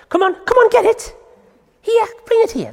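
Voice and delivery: high, eager coaxing with a laugh; excited dog talk. Real, not acted. Trainer calling her dog to bring the string, high excited voice.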